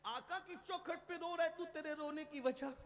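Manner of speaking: high-pitched, insistent question, softly projected; urgent questioning. Retold or imitated. Umar asks a man weeping at the Prophet's doorstep, urgent questioning. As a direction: raise the pitch and press for the reason.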